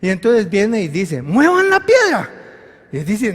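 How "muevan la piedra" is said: high, raised, emphatic order; acted command. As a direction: order it out firmly, pitched well up.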